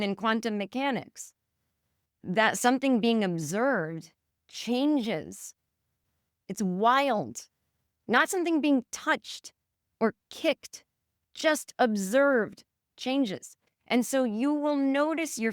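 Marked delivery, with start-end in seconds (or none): 2.2-5.5 slow emphatic. Acted. Stressing a physics point. 8.1-13.6 slow emphatic. Acted. Repeating the key point.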